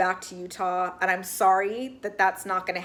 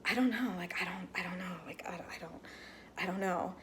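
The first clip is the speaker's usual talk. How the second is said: faltering quiet answer; 4 semitones lower, 14 dB quieter than usual. Retold or imitated. Retelling Christine's faltering answer.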